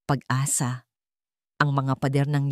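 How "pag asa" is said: usual speaking voice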